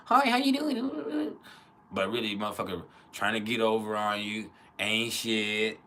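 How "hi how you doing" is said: very high, drawn-out mock-friendly greeting; super polite act. Fake-nice acquaintance greeting someone they dislike, super polite. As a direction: overdo the sugary hello, pitched way up. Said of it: "polite and super".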